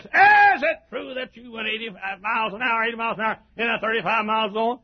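high-pitched, rapid courtroom grilling; hammed-up attorney's cross-examination; prosecuting attorney. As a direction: play a mock prosecutor firing off an accusation. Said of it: "the prosecuting attorney says".

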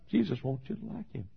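ordinary talk, nothing marked

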